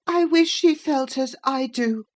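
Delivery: high, self-pitying whine; high boy's sulky whine; whining sickly boy. A narrator's reading. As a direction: complain to make her guilty. Linton complaining.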